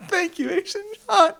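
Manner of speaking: high, loud, laughing thanks; squealing fanboy thanks; fanboy excitement. Real, not acted. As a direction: gush gratefully through laughter. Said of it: "I'm fanboying out over here".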